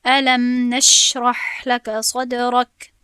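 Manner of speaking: loud, slow recitation of الم نشرح لك صدرك with sounds given their time; timed Quran recitation. Shown as a demonstration. Teacher demonstrating letter timing to students, slow loud recitation. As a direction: recite it loudly, holding the consonants in measure.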